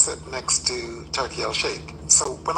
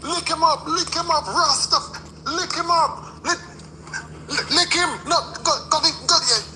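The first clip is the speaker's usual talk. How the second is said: ringside shouting; 13 semitones higher than usual. Retold or imitated. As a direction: cheer your fighter on at the top of your lungs. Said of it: "I was shouting".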